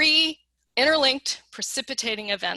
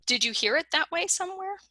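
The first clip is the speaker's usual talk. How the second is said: gently curious question; 8 semitones higher than usual. Shown as a demonstration. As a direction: ask it up high and kindly.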